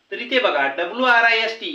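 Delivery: usual speaking voice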